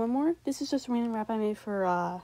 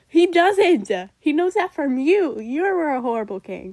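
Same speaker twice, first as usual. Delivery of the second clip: loud indignant retort; 3 semitones higher, 9 dB louder than usual. Real, not acted. Retorting to his own rap.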